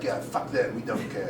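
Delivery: low, offhand dismissal; French part of me. Acted. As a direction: shrug it off softly, low. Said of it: "the French part of me is like".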